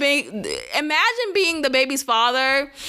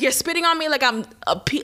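normal talking voice

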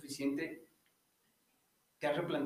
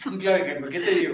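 laughing; 8 dB louder, faster than usual. Real, not acted. Joking back and forth laughing.

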